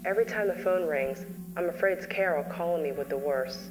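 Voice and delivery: ordinary talk, nothing marked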